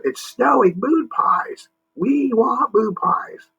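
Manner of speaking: high, slow little alien voices; squeaky Mars men calling out; excited Mars men. Acted. Mars men calling to each other, high and excited. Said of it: "they called out to each other".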